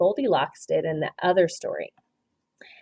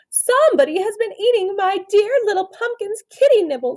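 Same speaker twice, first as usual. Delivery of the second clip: higher, fussy mother's line; mommy person voice; 12 semitones higher than usual. A narrator's reading. Teacher voicing the mommy person to the class, lightly fussy. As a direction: lift it like a worried mum. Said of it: "said the mommy person".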